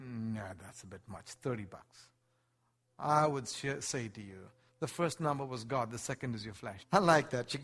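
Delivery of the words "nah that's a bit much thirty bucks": backing off. Retold or imitated. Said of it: "Then you think".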